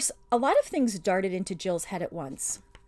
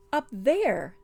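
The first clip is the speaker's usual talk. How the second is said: said stoutly; 5 semitones higher, 6 dB louder than usual. A narrator's reading. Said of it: "said Puddleglum stoutly".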